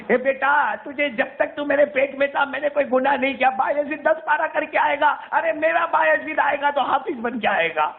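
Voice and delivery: high, pleading but firm mother's voice; mother refusing. Retold or imitated. Mother tells her son through the door to come back a hafiz. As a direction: hold the door shut with a heavy heart.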